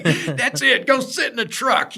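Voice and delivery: high loud laughing command; mock angry order. Boss sending the sick worker to the truck, mock angry order. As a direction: order him off like a fed-up boss. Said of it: "He's like".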